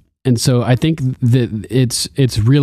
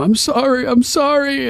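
mock desperate apology. Podcaster acting Theon begging Sansa, high and pleading. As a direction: whimper the apology like a broken man. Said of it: "just apologizing desperately".

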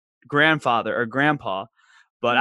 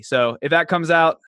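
ordinary talk

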